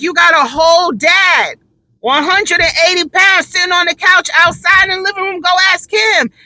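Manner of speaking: exasperated yell. Retold or imitated. Mom sending kids to dad on the couch, exasperated. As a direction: snap back at the kids, fed up. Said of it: "And I'm like".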